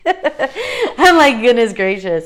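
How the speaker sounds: high laughing burst, bright and loud; laughing exclamation. Real, not acted. To viewers after a heartfelt rant, laughing loudly. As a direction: laugh it out at yourself.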